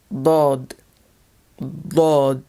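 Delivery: Dod with a heavy, dark D; emphatic D. Shown as a demonstration. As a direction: say Dod low, mouth barely open, leaning on the D. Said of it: "a very emphatic D sound".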